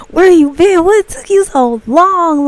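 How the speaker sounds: high-pitched playful fussing; hammed-up fussing, drawn out at the end; mock lover's complaint. Driver to cannolis on the passenger seat, mock reproach.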